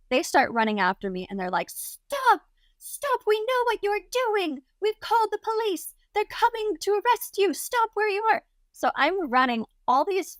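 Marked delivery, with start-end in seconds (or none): 1.7-8.4 high-pitched mock yelling; imitated chasing shouts. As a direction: imitate hotel staff calling after a runaway. Imitates hotel staff chasing her.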